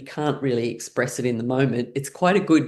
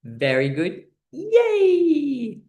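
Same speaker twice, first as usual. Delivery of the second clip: mock client praise with high yays; high squeaky yay; praising; cheery praise; 7 semitones higher, much slower than usual. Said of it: "And then say".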